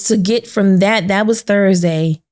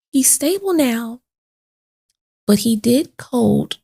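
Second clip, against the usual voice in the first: doctor's careful voice; 5 semitones higher than usual. Retold or imitated. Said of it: "I heard the doctor say".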